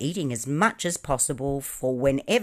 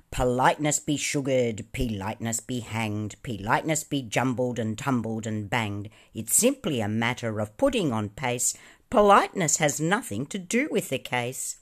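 ordinary delivery

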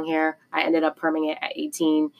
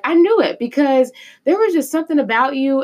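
excited; 7 semitones higher, 5 dB louder than usual. Retold or imitated. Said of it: "she was like".

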